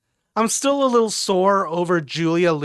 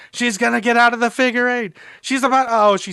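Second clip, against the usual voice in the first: relived high, loud excitement; loud, pitched-up rush of hope; excited match call; 7 semitones higher, 5 dB louder than usual. Retold or imitated. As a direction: cheer her on like a frantic fan.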